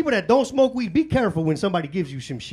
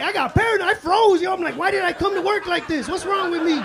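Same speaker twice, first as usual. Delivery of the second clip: high, loud paranoid panic; comic stoned paranoia, high and loud; panicked high; 10 semitones higher, 6 dB louder than usual. Retold or imitated. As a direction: freak out in a rising voice. Paranoia hitting at work.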